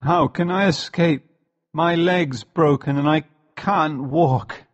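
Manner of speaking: weak, distressed. A narrator's reading. Injured boy tells Elsevier he can't walk, weakly. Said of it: "I said weakly".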